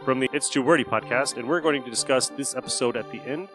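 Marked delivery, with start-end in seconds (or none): none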